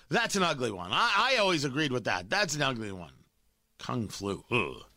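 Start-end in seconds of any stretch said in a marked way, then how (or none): 3.8-4.8 much lower, softer, a hammed-up groan of distaste; mock disgust. Host mocking the reporter's disgust at the phrase, low sneer. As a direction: sneer it like a prissy scold. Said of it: "That's what he sounds like".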